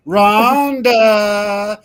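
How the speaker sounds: loud, long drawn-out name; playful calling out. Pretend. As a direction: call the name out slowly and loudly. Calling out a quiet guest.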